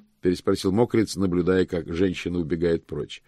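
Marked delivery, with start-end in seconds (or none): none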